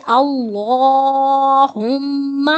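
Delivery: اللہم with a thick, full-mouthed heavy lam, drawn out slowly. Shown as a demonstration. Teacher showing students the heavy lam in اللہم, slow and full-mouthed. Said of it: "یعنی موٹا پڑھتے ہیں".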